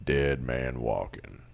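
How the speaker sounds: low, slow, ominous wrestler voice; slow, softened deep dead-man drone; The Undertaker. Acted. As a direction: drop deep and draw it out menacingly. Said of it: "I think of The Undertaker".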